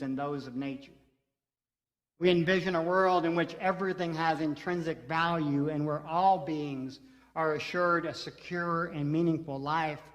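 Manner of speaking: measured, solemn reading of a covenant; formal reading. Acted. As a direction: read it like a public pledge. Reads covenant aloud to congregation.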